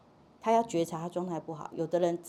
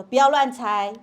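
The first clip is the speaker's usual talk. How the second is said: put-on louder, higher child snapping back; loud protest; 4 semitones higher, 11 dB louder than usual. Child to parent guessing the mood, loud protest. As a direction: push it out loudly in protest.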